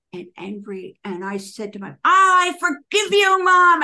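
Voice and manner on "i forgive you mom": high, loud, slow yell at the sky; furious forgiving yell to Mom; retold yelling. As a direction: shout the forgiveness out on a dog walk. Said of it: "yelling and shouting".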